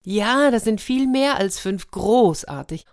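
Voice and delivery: high, delighted exclamation; elated, high-pitched leopard; beaming with joy. A narrator's reading. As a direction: cheer the wonderful news. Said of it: "der Leopard strahlte vor Glück".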